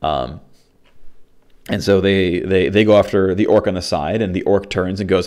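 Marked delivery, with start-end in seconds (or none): none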